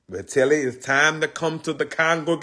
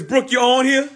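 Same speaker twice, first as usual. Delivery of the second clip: calling out; 11 semitones higher than usual. Real, not acted. Calling out to viewer Brooke.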